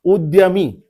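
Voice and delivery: उद्यमी slowly, as "ud-ya-mi"; half D, full Y. Shown as a demonstration. Teacher confirming the correct word, उद्यमी slowly as "ud-ya-mi". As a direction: draw out उद्यमी with a clear Y. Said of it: "आधा दा".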